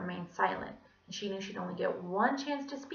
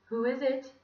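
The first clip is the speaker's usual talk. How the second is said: lightly voiced Scottish gentleman, a little higher; pleasant Scottish voice; 5 semitones higher than usual. A narrator's reading. Mister Gorf answering a knock, pleasant Scottish. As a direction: call it politely with a Scottish lilt. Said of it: "that very pleasant voice from Scotland".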